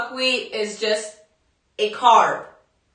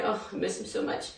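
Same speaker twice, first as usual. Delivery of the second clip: choked up; 3 semitones lower, 12 dB quieter, faster than usual. Real, not acted. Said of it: "I'm gonna start crying".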